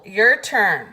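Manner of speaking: usual speaking voice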